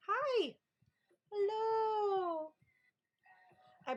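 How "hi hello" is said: very high, drawn-out hello; cutesy greeting. Real, not acted. Greeting a viewer.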